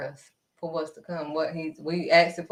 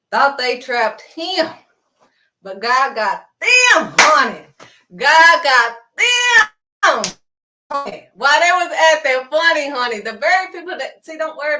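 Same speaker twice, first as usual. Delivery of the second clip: full-force preaching; 6 semitones higher, 9 dB louder than usual. Acted. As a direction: preach that God got them.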